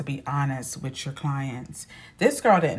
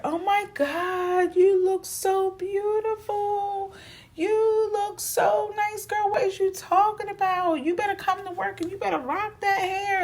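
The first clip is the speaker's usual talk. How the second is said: hyping friend; 3 dB louder than usual. Retold or imitated. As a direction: gush and hype her up.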